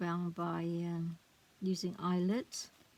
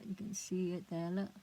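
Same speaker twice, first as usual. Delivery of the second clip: ordinary talk, nothing marked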